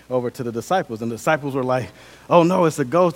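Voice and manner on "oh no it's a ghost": loud, raised mock alarm; freaked out. Disciples see a ghost on the water, mock freaked out. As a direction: play a spooked disciple, half in earnest. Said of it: "They're freaked out".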